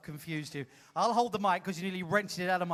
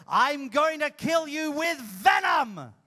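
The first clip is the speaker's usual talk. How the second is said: loud, higher, slow snarled threat; villain threat; 5 semitones higher, 3 dB louder, slower than usual. Shown as a demonstration. Host demonstrating the villain line, slow loud threat. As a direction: spit it out like a stage villain. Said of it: "and you go".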